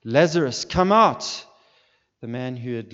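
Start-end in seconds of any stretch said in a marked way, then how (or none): 0.0-1.4 high, booming command across the distance; shouted summons, pitched high; loud cry. Acted. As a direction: cry it out with full force. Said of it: "he cried out in a loud voice".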